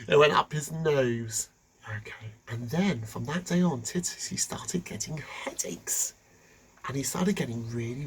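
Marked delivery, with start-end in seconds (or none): none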